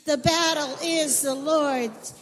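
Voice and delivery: high, loud, slow and full of conviction; said like you mean it. Shown as a demonstration. Leader urging the children to shout it with conviction. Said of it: "Oh, say it like you mean it".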